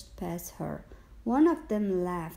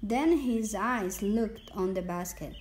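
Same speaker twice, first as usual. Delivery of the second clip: usual speaking voice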